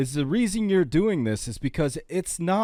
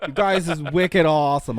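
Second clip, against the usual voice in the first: put-on Boston accent; 2 semitones higher, 3 dB louder than usual. Mock New England accent.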